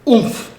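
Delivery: a bump sound as she lands; tumble oomph. Acted. Fiona landing in the snow with an oomph.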